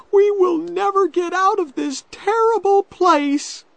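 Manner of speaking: put-on very high, loud girl's wail; despairing cry. A narrator's reading. Becky despairing of escape from the cave, crying out. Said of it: "cried Becky".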